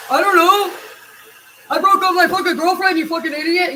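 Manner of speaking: high, loud furious snap; angry yell back. Retold or imitated. Depressed friend yelling at nagging people.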